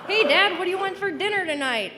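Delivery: high, loud hail, then rushed question; very high-pitched, quick, perky asking; over-cheery daughter. Retold or imitated. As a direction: coax dad with exaggerated pep. Chirpy dinner question to dad.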